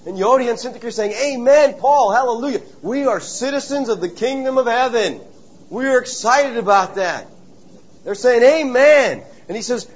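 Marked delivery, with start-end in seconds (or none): 1.1-7.3 excited amen. Pretend. Voicing joyful church ladies. 8.4-9.2 excited amen. Pretend. Ladies' amen.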